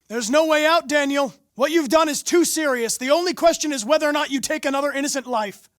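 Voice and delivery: high, loud, firm plea; urgent calling out. Acted. Negotiator calling to the hostage taker, loud firm plea. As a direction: call across to him, firm and urgent.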